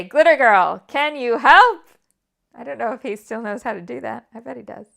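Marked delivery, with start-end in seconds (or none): 0.0-1.8 put-on loud, bright announcer call to Glitter Girl; the Adhesive Avenger's episode intro cue, called out loud; show host call. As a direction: call out to her like opening the show. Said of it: "then would say".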